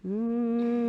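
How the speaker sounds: sustained hum; one long steady hum. Shown as a demonstration. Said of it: "Breathe in".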